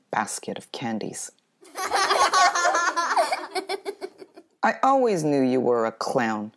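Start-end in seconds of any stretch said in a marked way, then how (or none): 1.7-4.4 much higher, louder gloating cackle; mocking laugh. Winning girl mocks the narrator. 4.7-6.5 louder, mocking sneer; taunting. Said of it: "she said when she passed me".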